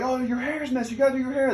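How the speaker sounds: mock fussing. Hazers fussing over hair, retold.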